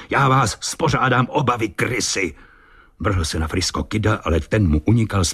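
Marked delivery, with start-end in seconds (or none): 0.1-2.3 higher-pitched threat, volume held back; enraged roar. A narrator's reading. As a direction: threaten them both as the furious captain. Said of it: "zařval kapitán celý vztekem bez sebe". (vzteklá výhrůžka)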